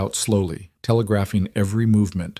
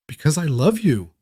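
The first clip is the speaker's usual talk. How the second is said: higher-pitched, pent-up confession; bursting out; 6 semitones higher than usual. A narrator's reading. Cole confesses love to Lydia, words bursting out. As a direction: let it burst out as if held back too long. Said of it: "The words burst out of him".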